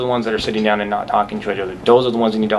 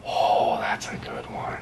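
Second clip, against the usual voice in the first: whispering; 9 dB quieter, much slower than usual. Real, not acted. Pondering a tough question.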